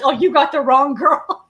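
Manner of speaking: usual speaking voice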